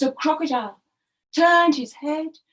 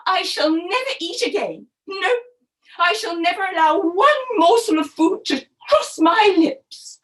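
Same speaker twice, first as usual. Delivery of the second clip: melodramatic, louder refusal; cross, building to a dramatic finish; 4 semitones higher, 3 dB louder than usual. Acted. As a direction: vow it like an outraged wife. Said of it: "Missus Crocodile was very cross now".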